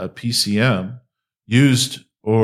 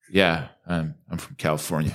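mock ashamed, quieter mumble; sheepish confession; 5 semitones lower, 5 dB quieter than usual. Instructor admitting to strangers he's from California, sheepish and quiet. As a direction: hang your head and admit it quietly. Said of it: "he hangs his head and says".

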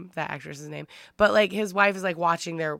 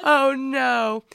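shocked gasp; 7 semitones higher, 6 dB louder than usual. Retold or imitated. Acting Bruce Willis's shock.

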